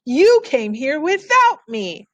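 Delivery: little brother accusing his sister; hurt accusation. Acted. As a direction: stamp it out like a wronged kid. Toby stamping foot, accusing.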